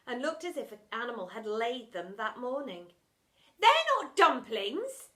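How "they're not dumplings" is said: high, loud outraged cry; exclaiming. Acted. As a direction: exclaim it, disgusted. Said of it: "exclaimed the girl".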